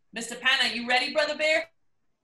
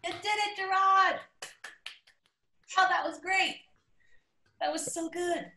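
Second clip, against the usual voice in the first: excited cheer; 8 semitones higher than usual. Real, not acted. Host cheering after reading.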